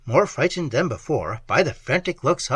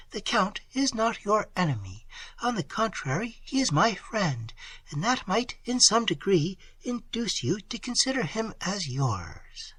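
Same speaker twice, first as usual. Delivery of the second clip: Emily calming him; 7 semitones higher, 5 dB quieter than usual. A narrator's reading. A young woman calming her frantic lover, soft and high.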